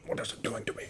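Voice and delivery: breathless whispered worry; mock-frightened whisper. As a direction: hush it like a secret fear. Drunk self mock-panicking over tequila.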